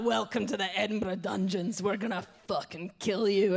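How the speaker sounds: menacing mock Scottish butcher; hammed-up, imperfect Scottish accent; attempted Scottish accent. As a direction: announce it like a Scottish horror-show host. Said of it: "I can't do the accent very well".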